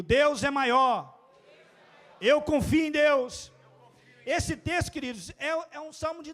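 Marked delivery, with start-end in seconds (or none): 0.0-3.5 loud, slow shouted affirmation; preacher leading a loud declaration. Real, not acted. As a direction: shout it slowly so the church repeats. Congregation repeating declaration.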